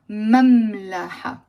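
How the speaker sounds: مملحة slow, holding the M in "mammm-laha"; double M shown. Shown as a demonstration. Teacher pointing out two Ms, مملحة slow with held M. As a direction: linger on both Ms of مملحة. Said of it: "There are two M".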